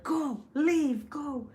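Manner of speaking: high, urgent mock plea; sad huntsman urging. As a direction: act out urging someone to flee. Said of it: "he felt sad, and he was like".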